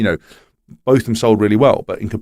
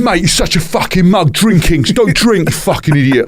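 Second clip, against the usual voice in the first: mocking jeering shout, higher and louder; mock abusive fan; 8 semitones higher, 3 dB louder than usual. Football fans mocking him online, jeering shout. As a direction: jeer like an angry football fan. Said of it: "all the football fans going".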